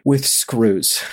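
low deadpan smart-aleck answer; drunken sarcasm. Retold or imitated. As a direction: state the obvious, low and dry. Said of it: "such a fucking sarcastic asshole".

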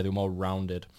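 usual speaking voice

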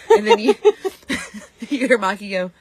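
ordinary talk, nothing marked

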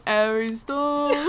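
giggly, hammed-up English vowels; English accent. Co-host copying the impersonation, English accent. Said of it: "that's actually how he talks".

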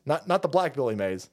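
usual speaking voice

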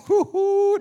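high, loud whooping laugh; whoo-hoo shrieked in delight. Real, not acted.

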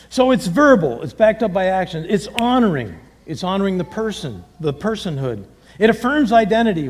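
ordinary delivery

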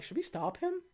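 high, soft little question; hesitant aside. Retold or imitated. M asking H about the alien, hesitant. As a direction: ask it small and unsure.

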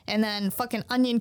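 ordinary talk

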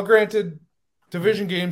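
ordinary talk, nothing marked

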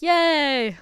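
loud, high cheer from the crowd; hammed-up churchy yay; mock cheer. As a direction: cheer like a congregation on cue. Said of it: "everyone's like".

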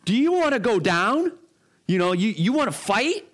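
hammed-up angry friend; mock irate challenge. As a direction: taunt him to fight, mock furious. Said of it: "so intense and so irate".

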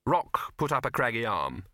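ordinary delivery, nothing marked

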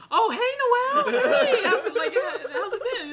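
high, loud, laughing sing-song greeting; mock happy girl. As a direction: ham up a bubbly girl saying hi. Imitating girl greeting happily.

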